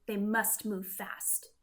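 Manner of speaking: ordinary delivery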